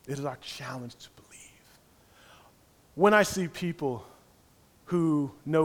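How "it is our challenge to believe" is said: fast hushed whisper; breathless whispered aside. Acted. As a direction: lean in and whisper it. Hushed moment in sermon.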